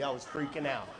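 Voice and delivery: usual speaking voice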